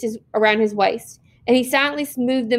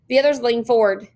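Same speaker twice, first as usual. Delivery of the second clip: ordinary delivery, nothing marked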